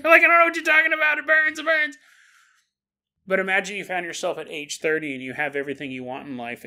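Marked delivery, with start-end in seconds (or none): 0.2-2.0 high, loud mock wail of torment; hammed-up anguished shout. Souls burning in hell cry out, hammed-up shout. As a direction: scream it like you're burning, played for laughs.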